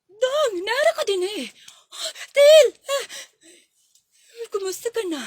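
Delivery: high, loud happy greeting; a mother home at last, shouting with joy; joyful shout. Acted. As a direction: call out to him on arrival.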